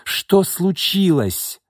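high, slow, stretched question; alarmed exclamation. A narrator's reading. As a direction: cry out, demanding to know. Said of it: "воскликнула она". (встревоженный возглас)

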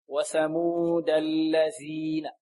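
وثمود الذين drawn out slowly, D then DH; careful correct reading. Shown as a demonstration. Teacher models the phrase slowly, D in وثمود, DH in الذين. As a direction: stretch وثمود الذين, keeping د and ذ distinct. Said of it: "فهكذا نقرأ".

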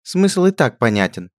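high, slow dismissive sneer; scornful. A narrator's reading. Princess brushing off the duchess, high slow sneer. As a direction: brush the correction off.

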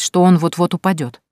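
usual speaking voice

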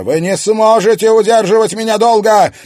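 high, loud menacing scream; furious threat. Acted. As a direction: hurl the threat at them. Possessed woman threatening from circle.

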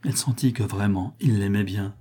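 ordinary delivery, nothing marked

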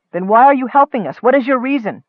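urgent challenge. A narrator's reading. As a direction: demand an answer from the stranger. Challenging a suspicious stranger.

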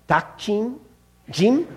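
"Dakjin? Jim?" tried out with a rising, questioning tone; tentative Korean pronunciation. Shown as a demonstration. Speaker showing the congregation a Korean dish name, tentative "Dakjin? Jim?". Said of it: "forgive my horrible pronunciation".

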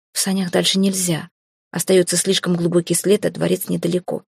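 ordinary delivery, nothing marked

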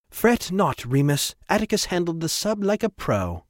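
high-pitched, confident rich commander; breezy, imperious Trevor. Acted. Trevor brushes off Remus's concern, high and breezy. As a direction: play a high-voiced, self-assured boss.

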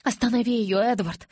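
usual speaking voice

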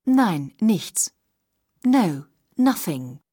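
ordinary talk

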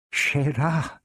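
stammering. A narrator's reading. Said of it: "she stammered, half in a whisper".

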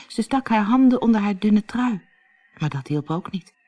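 ordinary delivery

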